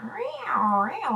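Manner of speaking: high meowing like a cat in heat; imitated cat meow. Cat in the backyard yowling, high mock meow. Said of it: "cat sounds".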